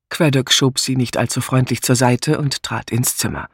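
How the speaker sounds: ordinary delivery, nothing marked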